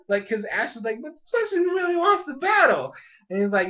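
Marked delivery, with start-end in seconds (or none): 1.0-2.9 high-pitched mock whine; pleading. Ash begging for Fletchling's battle, high mock pleading. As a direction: plead in a high, put-on wail.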